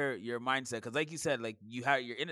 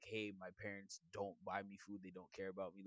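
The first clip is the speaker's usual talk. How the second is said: much softer student voice; softly imitating a student; quiet mock student; 4 semitones lower, 12 dB quieter than usual. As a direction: murmur like a hurt kid. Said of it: "or like".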